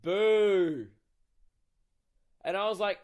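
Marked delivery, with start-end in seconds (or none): 0.0-0.9 loud, long drawn-out boo; mock yell. Someone yelling from the back of the room, loud boo. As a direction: yell it like a heckler. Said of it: "just yells".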